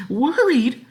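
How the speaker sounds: very high, loud outraged cry; shrill disbelief. Acted. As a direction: scoff shrilly. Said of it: "cried his wife in a high, shrill voice".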